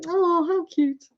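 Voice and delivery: high cooing "Oh, how cute"; cooing over an accent. Retold or imitated. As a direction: gush like hearing a cute accent. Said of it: "I bet we'd go".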